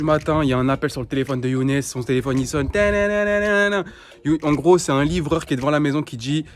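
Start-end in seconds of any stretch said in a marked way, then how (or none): 2.7-3.8 sung high, slow ringtone imitation; phone ringtone. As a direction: hum it like a phone ringing.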